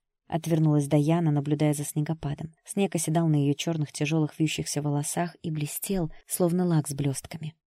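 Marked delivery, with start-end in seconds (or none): none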